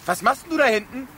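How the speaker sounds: a high reproachful call of Liberty. Acted. Sheriff calls Liberty back, reproachful and loud. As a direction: scold her across the room as you call her name. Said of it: "sag nicht immer so vorwurfsvoll Liberty".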